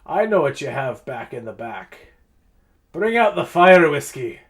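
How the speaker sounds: lightly voiced dwarf, emphatic interruption; dwarf ambassador. Ambassador demanding fire whiskey from bartender, mildly commanding. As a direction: cut in firmly as a commanding dwarf. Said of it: "Ambassador Braun Anvil, uh, stops you".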